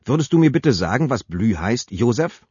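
drohend, höher und lauter, betont bitte; bedrohlich. Acted. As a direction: lass die Drohung in der Frage mitschwingen. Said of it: "Ihre Stimme hatte bereits etwas Bedrohliches". (drohend fragt Sabine ihren Mann Josef)

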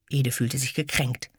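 ordinary delivery

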